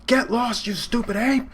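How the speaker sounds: high, snapping insult at the ape; furious high-pitched retort; pissed-off yell. Retold or imitated. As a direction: spit it out in contempt. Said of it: "freaking Neo's pissed".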